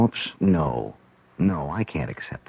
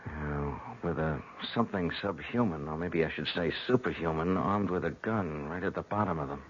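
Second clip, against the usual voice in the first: much softer, hushed tense talk; 11 dB quieter than usual. Acted. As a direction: keep it down, the enemy is close. Sneaking past armed zombie below.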